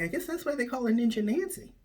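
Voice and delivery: fast, high quip; high-voiced joke delivery; punchline. Punchline quote of himself.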